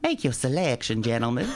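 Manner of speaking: hammed-up Southern madam; southern accent. Madam coming down the stairs to greet brothel customers, southern accent. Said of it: "That's my best southern accent".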